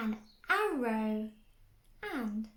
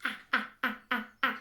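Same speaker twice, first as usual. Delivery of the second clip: quick-fire A A A; super speedy A sounds; rapid short A's; 3 dB louder, much faster than usual. Shown as a demonstration. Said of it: "really quickly?".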